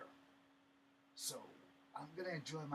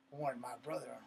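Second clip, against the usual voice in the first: normal talking voice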